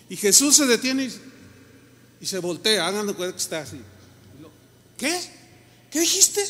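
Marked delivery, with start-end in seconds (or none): 4.9-6.4 sharp, high rebuke; Jesus turns and rebukes his disciples, raising his voice; raised voice. Retold or imitated. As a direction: snap back at the disciples with a raised voice. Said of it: "levantando la voz".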